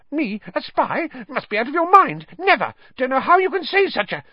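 very high, frantic squeaking; Pettigrew, breathless panic. A narrator's reading. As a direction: blurt out shrill denials, nearly panting. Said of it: "He was almost panting for breath".

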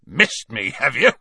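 loud, scornful snort of a question; snorting Hagrid. A narrator's reading. Hagrid scoffing at being missed, snorting. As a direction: scoff at them in disbelief. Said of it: "snorted Hagrid".

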